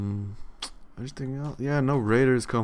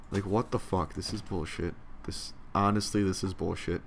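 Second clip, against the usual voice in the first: normal talking voice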